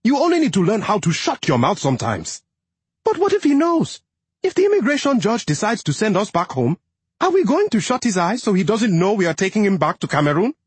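lightly voiced young wife, pleading; a hint of a worried wife; wife's voice. A narrator's reading. Narrator voices the wife Neni.

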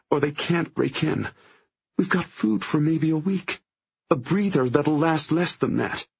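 high, cracking, on the verge of tears; voice breaking. A narrator's reading. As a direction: let the voice break with panic. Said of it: "Her voice breaks as she speaks again".

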